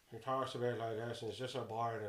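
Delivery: put-on boring old uncle in Northern Irish; low, droning Northern Irish accent; Colin. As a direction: drone on dully in Northern Irish. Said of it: "really boring old uncle called Colin".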